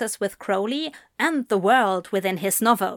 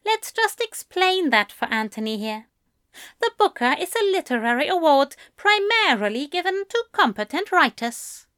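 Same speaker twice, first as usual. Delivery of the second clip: teasing host voice; 7 semitones higher than usual. A narrator's reading.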